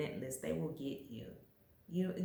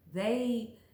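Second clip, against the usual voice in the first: ordinary talk, nothing marked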